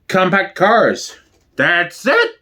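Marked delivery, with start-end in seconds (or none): 1.6-2.3 a sharp, higher, slower cry of I've had enough; fed-up outburst. Acted. Manya bursting out at the table, fed up. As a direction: she snaps at last and storms off. Guest storms off over pony.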